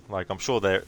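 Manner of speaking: ordinary talk, nothing marked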